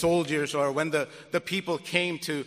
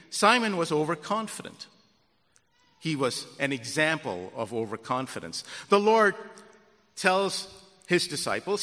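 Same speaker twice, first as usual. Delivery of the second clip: normal talking voice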